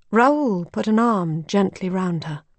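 plain reading voice

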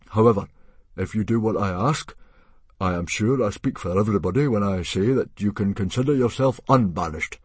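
loud, formal, awkward chieftain speech; awkward chief. Acted. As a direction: make an embarrassing request sound official. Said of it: "Stoic gave an awkward cough".

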